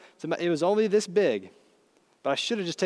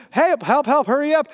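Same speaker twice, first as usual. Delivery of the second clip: high, loud cry for help; acted panicked yell; retold screaming; 8 semitones higher, 6 dB louder than usual. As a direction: call out in frantic urgency. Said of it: "I'm screaming".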